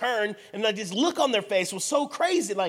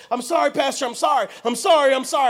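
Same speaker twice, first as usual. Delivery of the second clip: high, loud frantic apology; mock-tearful pleading, repeated in a rush; imitated crying apology; 6 semitones higher, 8 dB louder than usual. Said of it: "and he starts crying".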